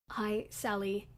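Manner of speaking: just woken, drowsy greeting; sleepy voice. Acted. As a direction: mumble it half asleep. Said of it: "he greeted me with a sleepy voice".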